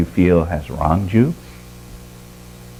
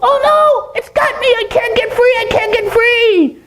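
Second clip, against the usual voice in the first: very high squeaky monkey voice; frantic falsetto monkey cries for help; panicked monkey. Acted. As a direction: squeal in alarm as a trapped little monkey. Voices trapped monkey in a story.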